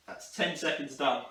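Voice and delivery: ordinary talk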